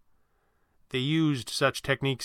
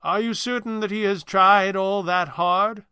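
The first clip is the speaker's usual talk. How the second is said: smiling question; 6 semitones higher, 5 dB louder, slower than usual. Acted. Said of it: "the stranger asked, smiling".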